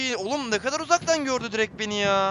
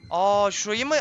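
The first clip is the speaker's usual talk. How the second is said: usual speaking voice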